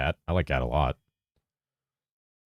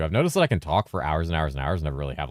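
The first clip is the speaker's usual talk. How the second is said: ordinary talk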